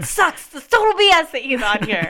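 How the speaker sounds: high, loud, playful indignation; hammed-up outrage. Fan objecting that Bulldog is on here, hammed-up outrage. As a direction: protest loudly in mock fury.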